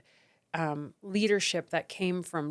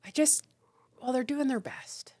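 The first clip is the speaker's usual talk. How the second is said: soft, high, forgiving voice; lightly imitated motherly sympathy; excusing mom; 5 semitones higher, 3 dB quieter than usual. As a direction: make gentle excuses for them, like a mom. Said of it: "like a woman mom thing".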